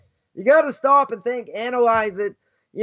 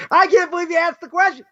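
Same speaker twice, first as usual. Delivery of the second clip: higher, louder mock disbelief; mock amazed; 6 semitones higher, 4 dB louder than usual. People mocking the question, mock amazed higher and louder. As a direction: ham up the amazed onlooker. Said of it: "They're like".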